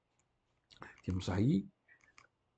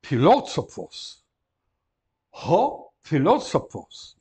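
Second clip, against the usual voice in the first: ho filÓsofos, slow and louder; slow model word; 2 semitones higher, 8 dB louder, much slower than usual. Shown as a demonstration. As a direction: pronounce carefully for the class. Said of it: "Outra palavra que conhecem todos".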